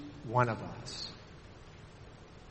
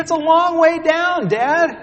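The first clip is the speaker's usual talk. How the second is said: put-on scared little girl; 10 dB louder than usual. Trapped daughter calling down to her father, frightened. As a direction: plead like a terrified little girl. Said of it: "She said".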